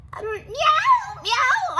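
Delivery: very high, loud meows; a cat meowing timidly at the door; nervous cat meow. A narrator's reading.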